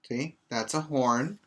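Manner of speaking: ordinary talk, nothing marked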